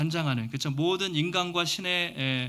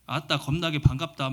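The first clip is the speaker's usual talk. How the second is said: a hint of a Jeolla pastor greeting on the phone; 전라도 사투리 흉내; Jeolla dialect. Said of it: "전라도는 어떻게 하죠?".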